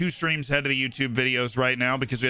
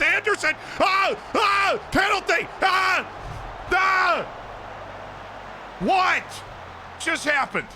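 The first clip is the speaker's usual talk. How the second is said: excited shout; slower than usual. Real, not acted. Streamer hyping his player winning a penalty, shouting. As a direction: roar it like a goal call. Penalty won, commentator hype.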